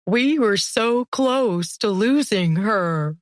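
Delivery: wailing. A narrator's reading. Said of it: "Grugin wailed".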